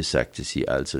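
ordinary delivery

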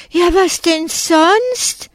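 hohe, sehr langsame entsetzte Frage; entsetzt. A narrator's reading. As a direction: frag es fassungslos und gedehnt. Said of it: "fragt Silke entsetzt". (Schülerin entsetzt zur Lehrerin, hoch und lang gezogen)

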